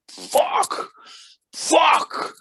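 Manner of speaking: hammed-up angry outburst. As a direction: spit out the curses like a raging rock star. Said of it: "he's really pissed off".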